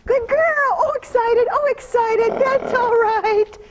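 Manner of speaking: high, loud gushing praise; match her excitement with a cheer; excited praise. Real, not acted. Trainer praising her lively mare, high and loud.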